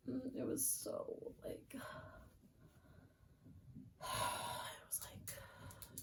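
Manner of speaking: trailing off into a whispered sigh. Real, not acted. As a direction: let it fade out on a breath. Exasperated sigh mid-rant.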